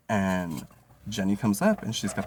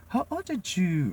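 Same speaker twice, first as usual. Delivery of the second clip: put-on higher, slow accented coworker voice; imitating coworker; 11 semitones higher, slower than usual. Coworker asking his age, higher mock accented voice. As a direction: ask it as the coworker would. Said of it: "she's like".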